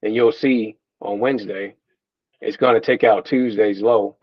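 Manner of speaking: ordinary delivery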